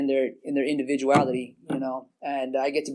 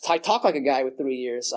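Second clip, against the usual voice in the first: normal talking voice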